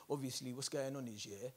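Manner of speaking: low, soft, knowing conspiracy-theorist voice. Retold or imitated. Comic mocking conspiracy theorists who blame China, hushed and knowing. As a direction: lean in like you're sharing a secret theory. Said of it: "They're like".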